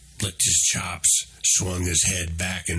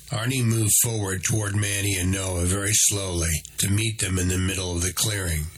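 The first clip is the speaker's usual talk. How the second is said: ordinary delivery, nothing marked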